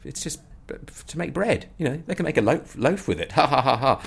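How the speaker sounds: high, breezy, laughing it off; light chuckling reassurance; carefree Jim. Retold or imitated. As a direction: play the jolly bandmate who sees no problem. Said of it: "Jim's just going".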